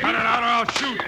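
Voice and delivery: shouting down two brawlers to stop; angry threat. Acted. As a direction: bark it to stop the fight. Bill breaks up a fight.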